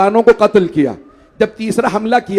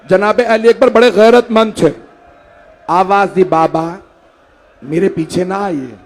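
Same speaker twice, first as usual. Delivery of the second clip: mourning recitation; 3 semitones higher, 3 dB louder than usual. Acted. Majlis lament narration.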